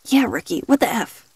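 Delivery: sleepy words through a yawn. Acted. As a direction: yawn while asking. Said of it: "Eric said with a yawn".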